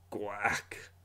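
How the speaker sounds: unvoiced whispered duck quack. Narrator adding a whispered quack sound. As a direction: breathe a tiny quack.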